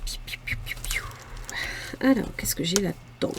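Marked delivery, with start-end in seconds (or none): 0.0-1.0 whispered piou sounds. Real, not acted. Playful noise while searching coins.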